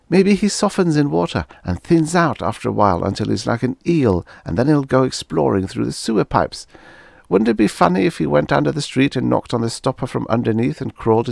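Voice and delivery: put-on high, quick girl voice with glee; gleeful girl. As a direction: spin the wild idea gleefully. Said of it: "in a burst of evil fantasy".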